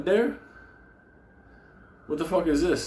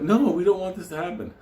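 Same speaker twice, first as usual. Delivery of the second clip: higher, louder whiny mock protest; mocking panicked investors; 9 semitones higher, 4 dB louder than usual. Mocking fund investors begging it not to happen, whiny and raised. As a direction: whine it like a scared investor. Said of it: "People are like".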